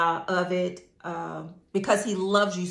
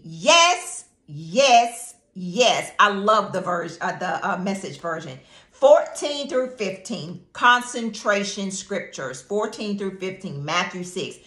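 normal talking voice

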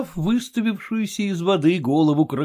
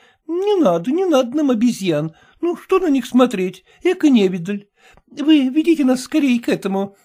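a hint of an impatient lady, raised; pushy wife, a touch higher; Glafira's voice; 10 semitones higher than usual. A narrator's reading.

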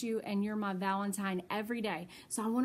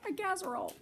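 laughing; 7 semitones higher, 4 dB quieter, much faster than usual. Real, not acted. Reader laughs at the gift.

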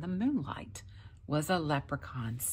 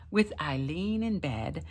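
ordinary delivery, nothing marked

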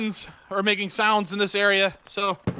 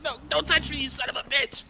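higher-pitched squirming protest; panicky protest; 5 semitones higher, 5 dB quieter than usual. Pretend. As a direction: fend them off in a raised, alarmed voice. Fending off intruder in game.

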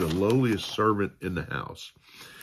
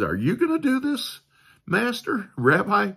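much higher, incredulous protest; brash Peter; 13 semitones higher, 4 dB louder than usual. Retold or imitated. Peter protesting Jesus washing his feet, high and incredulous. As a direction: push back in disbelief, pitched way up. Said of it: "sort of this brashness about him".

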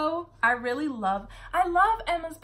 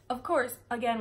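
usual speaking voice

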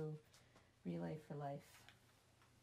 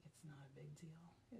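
soft whispered aside; whispered reassurance to viewers; 4 semitones lower, 13 dB quieter than usual. Real, not acted. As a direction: whisper it like a secret comfort. Confiding reassurance.

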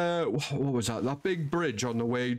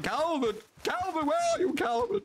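calling out higher and higher for the lost son; mock-panicked yelling of a name, rising; hammed-up search call; retold frantic shout.

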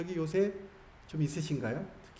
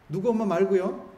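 high, loud mock call; 5 semitones higher, 7 dB louder than usual. Monk mimicking how women get called someone's mom, loud calling out. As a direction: call out as if hailing a neighbour. Calling moms by child's name.

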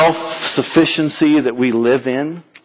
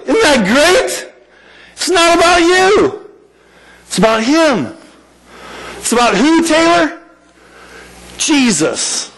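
excited preaching; 9 semitones higher than usual. Real, not acted. Preacher building to a high point.

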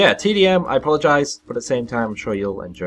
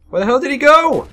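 high, loud cry of disbelief; incredulous high-pitched shout; baffled yell; 8 dB louder than usual. Real, not acted. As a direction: yell it, having suddenly lost the target. Loses sight of an enemy.

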